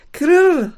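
loud, high rolled krrr; imitated crash. Narrator imitates the window shattering. (lautes, hohes Klirren nachgeahmt)

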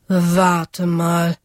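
a hint of an old man's grumble; grumbled. A narrator's reading. Old dragon telling the woodcutter to wait, lightly grumbled. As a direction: mutter it like a grumpy old man. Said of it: "brummte der Alte".

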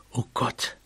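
very soft, shocked breath; stunned near-whisper; hushed dismay. A narrator's reading. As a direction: let it out quietly in dread. Mouse realises Susu is in danger.

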